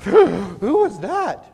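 loud, drawn-out mock amazement; astonished reaction. Passengers reacting after gunman is faced down, mock astonishment. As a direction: ask it slowly, high and stunned. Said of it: "and everybody's like".

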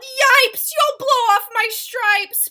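high, panicked zebra yell. A narrator's reading. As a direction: yelp it out loud in alarm. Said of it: "The zebra yelled".

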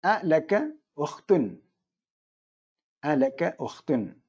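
each word dictated slowly with അ weighted; slow it down for the students; careful classroom model; slow model sentence. Shown as a demonstration. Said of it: "എന്താ പറയാ ഉപയോഗിക്കേണ്ടത്".

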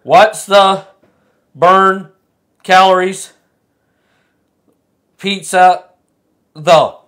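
loud, separate words with long gaps; halting word-skipping reading. Shown as a demonstration. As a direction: read it haltingly, counting as you go. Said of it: "leaving out every third word".

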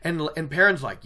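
ordinary talk

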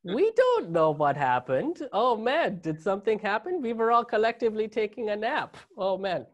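mock-innocent Germans playing dumb in a German accent. Comedian mocking German authorities in a German accent. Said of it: "the best German accent you guys are gonna get out of me".

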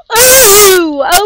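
sudden high, loud yelp that cracks. Real, not acted. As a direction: let the voice break on a startled shout. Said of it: "Oh, voice crack".

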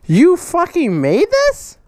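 slow, stretched amazed question rising at the end; awed, drawn-out disbelief at the gift; astonished disbelief. Real, not acted. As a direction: drag it out in stunned disbelief. Amazed at welded rose.

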